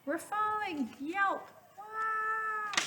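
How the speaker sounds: put-on high, far-off little kid squeal; cartoon kid falling; panicked kid voice. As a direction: squeal like a frightened child.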